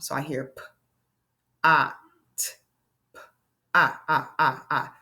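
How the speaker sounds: put-on phonics tapping for children; tapping out pot. Shown as a demonstration. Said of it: "Listen to the vowel sound".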